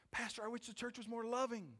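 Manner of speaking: high-pitched imitation of a church member; pleading to the pastor, high and put-on; mock complaining congregant.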